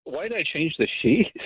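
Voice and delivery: stalling question that tips into a laugh; real laugh escaping after the question; nervous laughing. Real, not acted. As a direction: echo the question, then burst out laughing. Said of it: "what are you laughing about".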